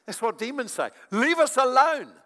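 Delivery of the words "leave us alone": loud, high, drawn-out cry; demonic yell. Acted. Demon's words shown again to congregation, loud acted yell. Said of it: "What the demon yelled out".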